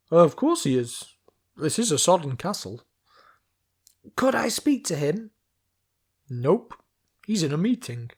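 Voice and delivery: lightly voiced guard, loud and offhand; guard shouting down. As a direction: toss it down casually. Guard answering from the wall.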